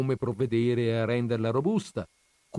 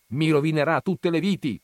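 loud, raised-pitch protest; exasperated shout, pitched high; shouted complaint; 6 semitones higher, 6 dB louder than usual. A narrator's reading. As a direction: cry out in dismay. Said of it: "mio zio gridò".

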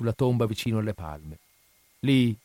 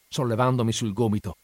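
usual speaking voice

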